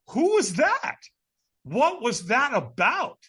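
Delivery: high, loud, bewildered questions; baffled disbelief. Retold or imitated. Man stunned by a vanishing stranger, baffled disbelief. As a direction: stretch the second question in astonishment.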